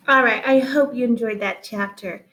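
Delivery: plain reading voice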